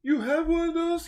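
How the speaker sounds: squeaky, high surprised gasp-question; bright magical girl. Retold or imitated. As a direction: shoot up high in disbelief. Haruki's surprised question quoted.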